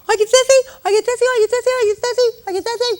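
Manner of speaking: high, loud panicky repeated question; frantic nurse. Acted. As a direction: mimic an over-anxious nurse fussing in a high pitch. Mimics frantic nurse running in.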